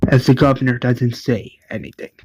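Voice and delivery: ordinary delivery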